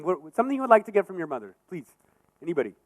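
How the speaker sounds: usual speaking voice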